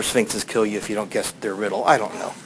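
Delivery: ordinary delivery, nothing marked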